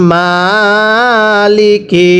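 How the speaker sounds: Maliki stretched very slowly; teacher models Maliki slowly; slow drawn-out reading. Shown as a demonstration. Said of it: "আরও একবার পড়বেন".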